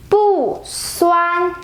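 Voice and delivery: "bu" falls from high, then "suan" stays high and level; unchanged fourth tone. Shown as a demonstration. Teacher showing bu before first tone: falling "bu", high level "suan". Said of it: "bu stays the same when it goes with the".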